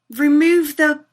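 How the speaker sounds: usual speaking voice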